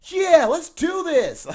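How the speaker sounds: high, loud, slow hammed-up action-hero rally; rally the team like a Saturday-morning cartoon; boy copying Transformers heroes, loud and high; cartoon-hero talk. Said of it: "I used to literally talk like that".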